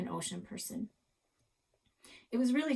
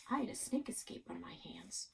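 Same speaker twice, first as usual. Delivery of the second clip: whisper; 8 dB quieter than usual. A narrator's reading. Narrator dread aside.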